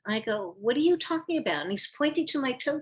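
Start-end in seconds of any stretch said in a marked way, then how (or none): 0.6-1.6 high, quick, incredulous question; retold bewilderment, rattled off fast; mock-confused retort; baffled. Retold confused reply to him.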